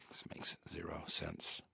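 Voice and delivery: whispered grumble under breath; muttered aside. Acted. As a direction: mutter it to yourself.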